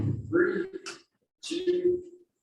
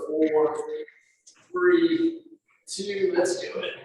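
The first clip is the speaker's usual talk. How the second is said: normal talking voice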